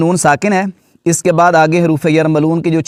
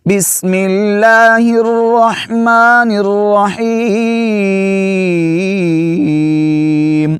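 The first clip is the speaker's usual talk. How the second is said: slow high melodic tilawat; Quran recitation; 4 semitones higher, much slower than usual. Acted. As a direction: recite slowly with long drawn vowels.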